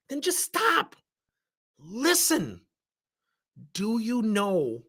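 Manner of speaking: high, firm, measured command; forceful. Retold or imitated. Manager halting an employee's excuses, forceful. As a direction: make them stop and listen, pitch up. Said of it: "I did that very forcefully with her".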